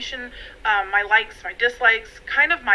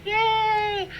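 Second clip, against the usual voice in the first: excited yay; 10 semitones higher than usual. Real, not acted. Cheers meeting her nutritionist.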